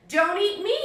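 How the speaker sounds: high, loud cry from the cookie; little cookie shouting as he escapes; shouted. Acted. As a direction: yell it as the fleeing gingerbread man. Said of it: "he shouted".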